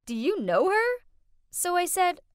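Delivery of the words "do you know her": louder, higher surprised question. A narrator's reading. Rich girl questioning her friend about the maid, surprised. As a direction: ask it in startled surprise, pitch rising. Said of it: "Jasmine looked surprised and said".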